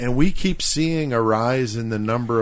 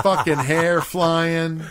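slow-motion Baywatch, laughing through it; 5 semitones higher, slower than usual. Shown as a demonstration. Mocking a friend's beach run, slowed down. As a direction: draw each word out like a replay. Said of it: "Like slow-mo".

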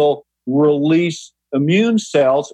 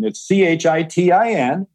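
usual speaking voice

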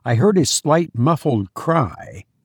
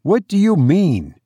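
astonished question, pitched higher; growing astonished; 6 semitones higher than usual. A narrator's reading. Narrator questions the unseen stranger, astonished. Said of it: "growing more and more astonished".

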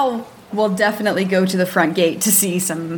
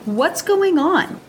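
high, drawn-out alarmed question; worried Mal asking a guard; insistent questioning; 10 semitones higher, slower than usual. Acted. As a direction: demand an answer, stretched and pitched up. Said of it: "how do I ask this?".

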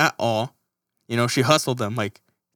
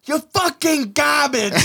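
mock angry movie insult, high and slow; movie quote; 10 semitones higher, slower than usual. Imitating a movie insult to a friend, high and drawn out. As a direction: sneer it like a movie villain. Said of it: "Don John reference".

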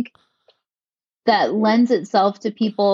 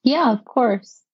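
normal talking voice

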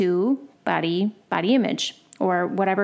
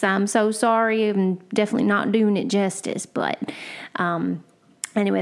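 usual speaking voice

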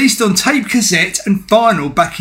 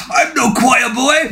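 slow, higher mock Mister T shout; Mister T impression; 6 semitones higher, slower than usual. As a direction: bark it like a tough guy facing the devil. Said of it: "best Mister T impression I've ever heard".

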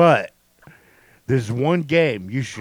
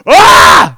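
startled scream; 18 dB louder than usual. Retold or imitated. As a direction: yelp like a jump scare. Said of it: "He just went".

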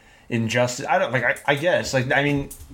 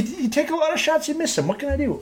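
sheepish squeak; 12 semitones higher than usual. Real, not acted. Laughing off a wrong actor guess.

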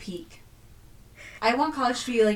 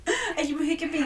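laughing through hiccups, higher; 6 semitones higher, 4 dB louder than usual. Real, not acted. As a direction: giggle the words out.

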